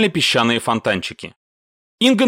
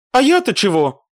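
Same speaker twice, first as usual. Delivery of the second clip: high, wide-eyed protest; playing innocent; 9 semitones higher, 3 dB louder than usual. A narrator's reading. Mashka answers her scolding commander, playing innocent. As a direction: act blameless in a raised voice. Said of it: "хлопая ресницами".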